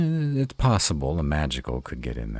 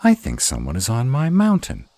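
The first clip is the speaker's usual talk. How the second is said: indignant alarm; 9 semitones higher, 8 dB louder than usual. Acted. Intruder on his mountain.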